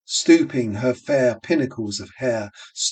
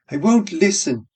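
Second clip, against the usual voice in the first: cried out; 6 semitones higher than usual. Acted. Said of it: "she cried".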